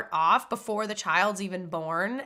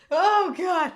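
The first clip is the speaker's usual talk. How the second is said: high, tearful laughing groan; crying and laughing; 8 semitones higher, 3 dB louder than usual. Real, not acted. Streamer overwhelmed by own tears, crying and laughing. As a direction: let it out through laughter and tears. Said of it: "crying and laughing".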